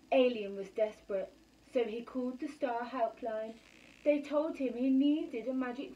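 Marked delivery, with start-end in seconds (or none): none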